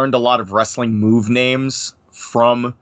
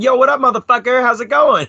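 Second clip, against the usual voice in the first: hyped greeting; 13 semitones higher than usual. Retold or imitated. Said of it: "we'll just like".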